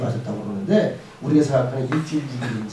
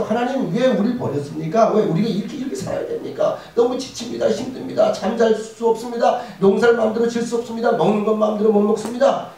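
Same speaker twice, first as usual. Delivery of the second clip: desperate plea; 6 semitones higher than usual. Acted. Israelites crying out to God.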